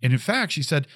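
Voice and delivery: ordinary talk, nothing marked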